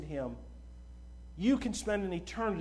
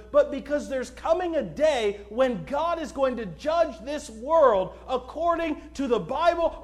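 urgent, raised preaching voice; full-force preaching; 4 semitones higher, 5 dB louder than usual. Acted. Preacher urging unsaved listeners to repent, full-force preaching. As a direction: press the warning on the congregation.

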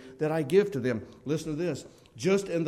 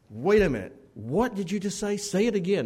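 surprised; 6 semitones higher, 4 dB louder than usual. Retold or imitated. Startled by the drillers' news.

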